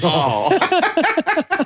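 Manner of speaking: a startled high "Oh" with a laugh; mock shock on hearing bad news; high dismayed oh. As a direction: squeak out a hurt little oh. Retold dismayed reaction.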